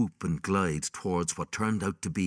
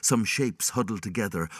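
usual speaking voice

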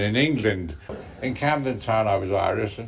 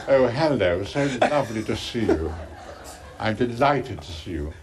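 a hint of a posh English accent with a laugh. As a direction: play the posh English greeting, half laughing. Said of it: "And then talking like that".

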